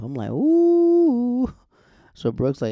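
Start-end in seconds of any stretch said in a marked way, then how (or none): 0.3-1.5 long, high, loud ooh; mock-shocked ooh. Listener reacting to Brooke calling Peyton out, hammed-up ooh. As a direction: hold a high hooting ooh at the burn.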